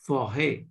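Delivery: forêt with an open eh, silent s; French forêt. Shown as a demonstration. Teacher models forêt for the student. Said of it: "this word become".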